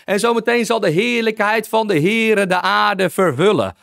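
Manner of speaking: high, driving preacher's cry, building; fiery preaching. Acted. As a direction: preach it as Jesus foretelling the harvest. Preaching Jesus' words, rising. (vurig prekend, steeds hoger)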